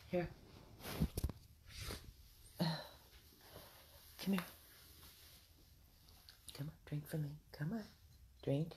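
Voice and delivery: soft whispered coaxing. Real, not acted. Owner urging her dog to drink water, gentle whisper. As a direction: whisper encouragement to a sick pup.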